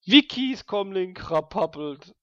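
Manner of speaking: slow, high sing-song ringtone; mock phone ringing. Player mimicking the comlink ringing, high and slow. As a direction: ring it out like a phone.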